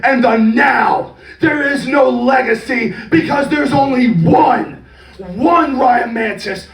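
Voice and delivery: wrestling heel promo. Pretend. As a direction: cut a big arena promo, all bluster. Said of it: "was a babyface promo".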